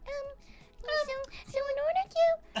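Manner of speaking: squeaky high cartoon cat voice; cat voice filter. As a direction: speak like a chipmunk-pitched cat. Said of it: "that cat going like".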